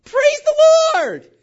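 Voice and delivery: high, loud churchy exclamation; mock the over-eager believer; gushing praise. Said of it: "they went like this".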